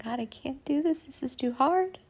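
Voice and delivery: high, tearful pleading, rushing at the end; whimpering plea. Retold or imitated. Retelling how she begged God, high and whimpering. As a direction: beg like a small frightened child. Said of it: "like this whimpering little child".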